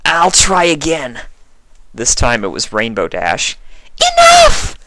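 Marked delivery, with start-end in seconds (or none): 0.1-1.2 higher, louder, flat offer; lightly voiced changeling. A narrator's reading. Changeling in friend form. 4.0-4.8 high, much louder furious shout; angry outburst. A narrator's reading. Fluttershy confronts changeling.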